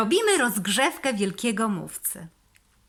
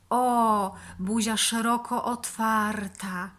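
ordinary delivery

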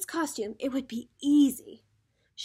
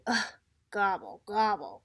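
low, frustrated turkey grumble; grumbling; 5 semitones lower, 4 dB quieter than usual. A narrator's reading. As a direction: grumble it low with a disgusted ugh. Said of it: "grumbled Turkey".

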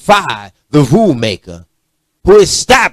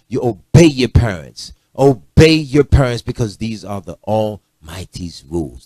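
grandmother's command; 5 semitones lower than usual. Retold or imitated.

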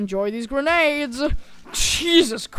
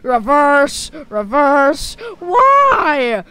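crying shout; 2 semitones higher, 9 dB louder, much slower than usual. Acted. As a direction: cry out in pain. Ragdoll crying as car reverses.

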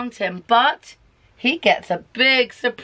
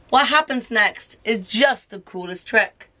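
ordinary delivery

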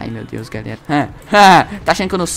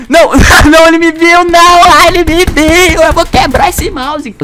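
loud, high panicked yelling; 10 semitones higher, 13 dB louder, slower than usual. Real, not acted. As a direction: yell in laughing panic. Enemy spots him, he yells.

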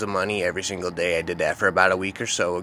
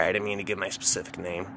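ordinary delivery, nothing marked

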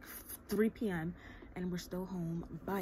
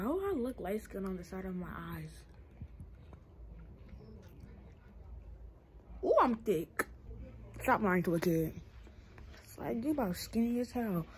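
lips around a straw; speaking between sips; talking with straw in mouth; 5 dB louder than usual. Real, not acted. Seen 0: Straw held at or in her lips throughout, sipping around 3.7.

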